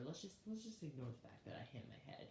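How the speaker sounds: half-whispered mock brush-off; hushed aside. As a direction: drop to a whisper, as if hushing it up. Mock brushing-off of the head injury.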